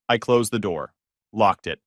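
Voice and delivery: usual speaking voice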